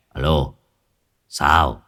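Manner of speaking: put-on low, lazy drunken slur; slurred drunk voice. A narrator's reading. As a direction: drag the words like a half-drunk man. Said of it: "rồi giọng lè nhè".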